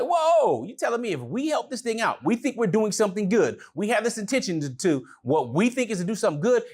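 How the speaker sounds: high-pitched retold disbelief; astonished reaction. Pupil reacting to teacher's warning, high retold astonishment.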